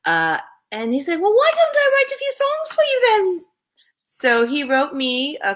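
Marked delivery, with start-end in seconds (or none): 1.2-3.5 high, loud, badly imitated accent. Singer offering a young model songs, bad imitated accent. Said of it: "doing a very bad [name] accent".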